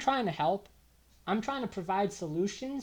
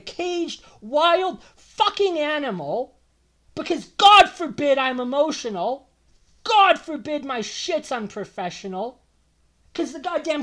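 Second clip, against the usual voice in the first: louder and higher, venting real fury; angry outburst; 8 semitones higher, 10 dB louder than usual. Real, not acted. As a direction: let the anger burst out about being caged. Venting about hospital mistreatment.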